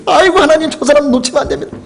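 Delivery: high, hurried retold plea; frantic plea. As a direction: beg God in a high-pitched rush. Retold frantic plea during car chase.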